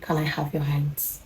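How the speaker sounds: soft, calm imitation; hushed, unhurried quoted question. Neighbor about to draw visitor closer, calm and soft. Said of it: "and just calmly said".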